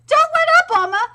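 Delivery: high, loud encouragement; cheering shout. Real, not acted. Fan cheering Oma on, shouting. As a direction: urge him on.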